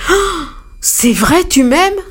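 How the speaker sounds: elephant exclaiming. A narrator's reading. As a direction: gasp it out with childlike joy. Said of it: "L'éléphant s'exclama".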